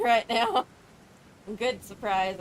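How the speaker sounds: usual speaking voice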